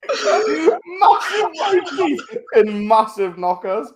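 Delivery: high, slow, laughing through the words; high slow laughing mockery. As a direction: cackle the words out slowly. Mocking imitation, laughing.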